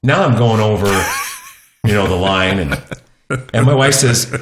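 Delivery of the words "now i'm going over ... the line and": ordinary talk, nothing marked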